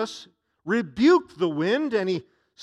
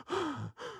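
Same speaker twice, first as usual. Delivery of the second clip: stunned gasp; 11 semitones higher, 10 dB quieter than usual. Acted. Disciples stunned after calm.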